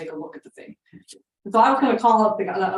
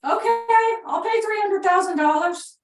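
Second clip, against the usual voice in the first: high, loud call of his name; shouting into the phone; 12 semitones higher, 4 dB louder than usual. Retold or imitated. Caller shouting to a deaf old owner on the phone. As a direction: yell so a deaf man can hear you. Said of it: "And so I started screaming".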